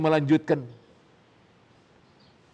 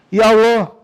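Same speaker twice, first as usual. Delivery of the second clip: anguished cry; 6 semitones higher, 10 dB louder than usual. A narrator's reading. A sufi crying out to God in anguish, loud wail. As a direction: call out Ya Allah in despair.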